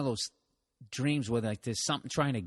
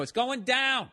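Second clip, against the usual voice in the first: higher, louder yell of "It's going down."; 12 semitones higher, 4 dB louder, slower than usual. Retold or imitated. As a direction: shout it over the old lady's head. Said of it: "I yelled over".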